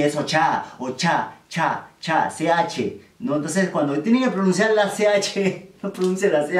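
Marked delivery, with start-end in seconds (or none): 0.2-2.3 "ocha" and "cha" with a crisp tch; emphatic CH. Shown as a demonstration. Teacher insisting on the correct ch in "ocha". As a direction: hit the ch in "ocha", then isolate "cha". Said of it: "Y no hay ninguna C H ahí".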